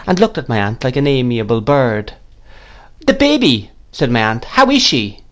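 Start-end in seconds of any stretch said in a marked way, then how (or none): 3.1-3.7 high-pitched, insistent clarification; sharp. A narrator's reading. Aunt sharply demands news. 4.4-5.2 high-pitched, insistent clarification; sharp. A narrator's reading. Aunt sharply demands news.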